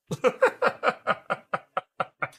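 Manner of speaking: high, staccato chuckle; laughing. Real, not acted. Streamer laughs about losing his voice, high chuckle. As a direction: let out a high, rapid run of laughter.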